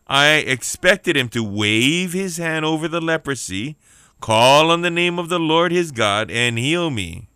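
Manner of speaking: hammed-up outrage, stretched words; angry. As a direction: declaim it like an affronted great man. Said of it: "But Naaman became angry and stalked away".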